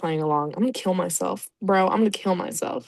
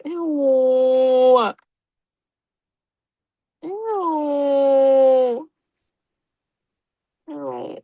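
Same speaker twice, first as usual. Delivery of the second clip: disgusted ew; 4 semitones higher, 3 dB louder, much slower than usual. Real, not acted. Grossed out by fanfic scene.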